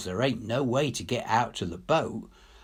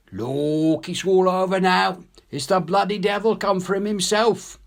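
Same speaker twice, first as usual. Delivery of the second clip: Jock's fright; 7 semitones higher, 4 dB louder than usual. Acted. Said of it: "he says".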